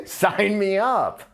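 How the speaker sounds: hammed-up surrender, dragging it out; eager French soldiers. French soldiers seeing the Germans arrive, mock eager. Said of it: "they're like".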